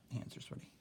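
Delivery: soft, voiceless whisper to oneself; under the breath, barely there; whispered aside. Real, not acted. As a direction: drop to a whisper for a private aside. Muttered aside while writing.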